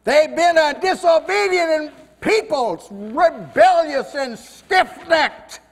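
Moses answering. Acted. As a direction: let Moses complain about his stubborn people. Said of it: "He'd say".

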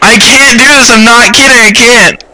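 high, frightened pleading; panicked protest. Real, not acted. Refusing to keep playing, scared.

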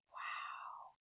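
breathless whispered wow; awed whisper. Acted. Jack staring up at the longest rope ladder, awed whisper. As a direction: whisper it, amazed. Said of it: "he whispered".

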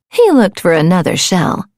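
plain reading voice

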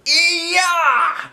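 very high, loud war whoop; battle cry. Acted. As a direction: holler a charging yell. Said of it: "I hollered".

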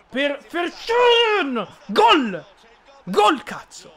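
screaming goal, high and loud. Real, not acted. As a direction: yell it out in joy.

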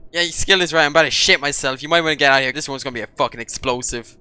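usual speaking voice

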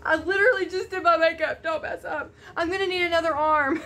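fast, loud, half laughing, half crying; laughing through tears. Real, not acted. Teary and laughing, she frets over her makeup.

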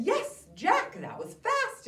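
bright, high-pitched mom praising Jack; mother's voice, high and loud. Acted.

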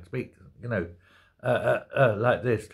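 hesitant low mumble, stalling on uh; Joe Biden. Retold or imitated. Host imitating the frail Biden he remembers, hesitant and low.

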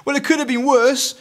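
high, loud defensive protest; insisting. A narrator's reading. Eric excuses himself to Dad, insisting. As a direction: insist it, pitched right up. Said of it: "Eric insisted".

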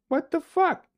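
high startled shout; shocked yelp. Real, not acted. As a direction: yelp in sudden fright. Jump scare, high pitch.

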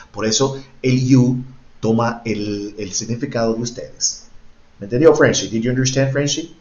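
normal talking voice

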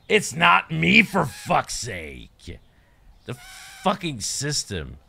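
high, loud cry, then dragged out slowly; exasperated outburst. Real, not acted. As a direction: blame the game, furious and drawn out. Blaming the game's broken system.

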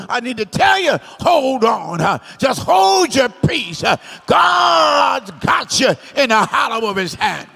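loud, drawn-out preacher's shout; shouted encouragement to the congregation; full-force preaching. Real, not acted. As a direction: preach it out loud, stretching the key words.

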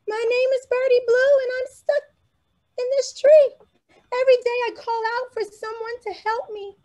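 very high, quick little bird voice; a tiny bird telling its trouble; high bird voice, sad and hurried; small bird voice. Acted. Birdie answers boy.

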